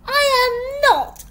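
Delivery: high, loud, outraged denial; flustered indignation. A narrator's reading. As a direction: protest hotly at full pitch. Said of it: "Esmeralda went scarlet".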